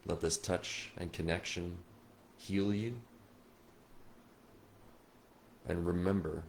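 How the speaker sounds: hushed and soothing, guiding them through a loved one's touch; guided meditation. Acted. Medium guiding grieving viewers through a spirit connection, soft meditation voice.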